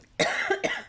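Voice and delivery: a real coughing fit; cough, then apologise. Real, not acted. Crafter showing her album, coughing mid-video. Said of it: "je tousse encore".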